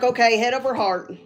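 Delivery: ordinary talk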